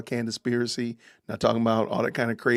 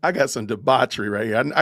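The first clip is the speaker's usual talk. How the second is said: usual speaking voice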